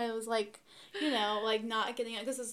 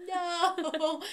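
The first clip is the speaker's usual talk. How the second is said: high, loud laughing groan of despair; a dismayed no through a laugh; 8 semitones higher, 8 dB louder than usual. Retold or imitated. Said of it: "and I was like".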